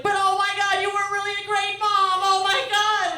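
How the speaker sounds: high, over-sweet mock adoration; fake gushing praise. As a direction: lay the praise on thick and fake.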